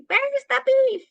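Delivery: put-on high, loud old-lady demand; old ladies in an ad. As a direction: cry it out like an old lady in a commercial. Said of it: "these old ladies that would pick up the bun and they would say".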